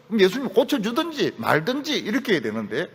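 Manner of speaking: usual speaking voice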